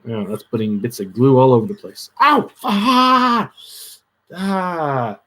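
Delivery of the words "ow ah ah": sharp high ow, then drawn-out aahs; real pain yelp. Real, not acted. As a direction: yelp from a sudden hot-glue burn. Burned by hot glue.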